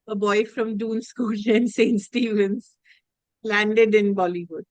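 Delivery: ordinary delivery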